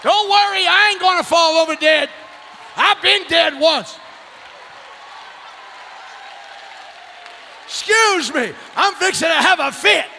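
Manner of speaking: loud shouted praise preaching; preacher shouting his love for the Lord. Acted. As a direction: proclaim each line to the church.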